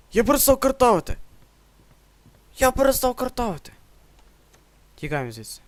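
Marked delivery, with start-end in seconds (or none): 0.1-3.7 високо й гучно, з переляку; викрикни налякано, набагато вище; наляканий крик. Real, not acted. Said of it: "Знову я зналякався і перестав картавити". (наляканий високий вигук)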